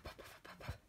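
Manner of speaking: soft breathy puffs of air; puffing snore. Retold or imitated. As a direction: puff out each snore. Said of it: "sounds like a puff".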